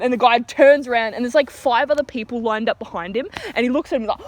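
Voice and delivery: usual speaking voice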